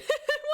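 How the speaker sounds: sudden high laugh; high burst of laughter. Real, not acted. Laughing at a viewer's good pun. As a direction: crack up at the pun. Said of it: "That was actually really, really good".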